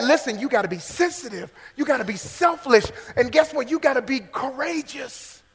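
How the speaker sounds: ordinary delivery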